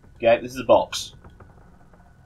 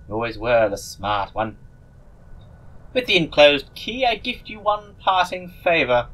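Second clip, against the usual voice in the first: silly voice; 2 semitones higher than usual. Acted. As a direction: draw it out like a goofy sorcerer. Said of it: "I'm gonna give this person a silly voice".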